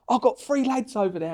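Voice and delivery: rough imitation of the judge; Bob impression. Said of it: "crappy impression of Bob".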